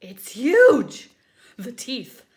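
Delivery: very loud frightened exclamation; alarmed cry. Acted. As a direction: blurt it out in alarm. Pigeon alarmed.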